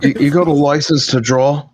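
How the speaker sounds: put-on British accent. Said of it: "it's the U K".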